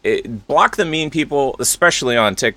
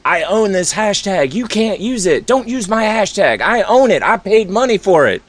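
higher, slow mocking whine; possessive whine; 6 semitones higher than usual. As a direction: parody a petty owner, a bit higher. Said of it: "people are like".

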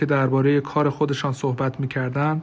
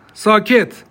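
loud, high one-word command; calling for silence; 7 semitones higher, 6 dB louder, much slower than usual. Acted. Judge silencing the courtroom, loud command. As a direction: cut through the murmuring with a raised voice.

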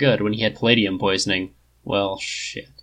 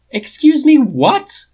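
high, loud disbelief; incredulous outburst at the plan; incredulous cry; 7 dB louder, much slower than usual. A narrator's reading. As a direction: cry out in shock. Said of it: "Tony cried incredulously".

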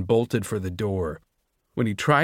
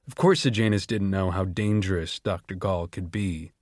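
normal talking voice

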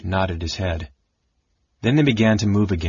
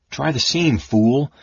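raised, sharp self-reproach; severe self-scolding; 5 semitones higher, 3 dB louder, slower than usual. A narrator's reading. The kinder scolds himself over the seam, severely. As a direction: chide yourself sternly. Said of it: "he scolded himself severely".

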